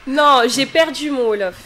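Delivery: giggling owning up; laughing admission. Real, not acted. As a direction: confess it through a laugh. Laughing admission after being teased.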